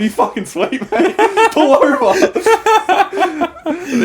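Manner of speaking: words breaking into high laughter; can't get through it for laughing; laughing while telling. Real, not acted. Recalling his Uber driver, laughing through the story.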